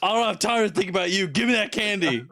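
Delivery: mock dad. Voicing the dad.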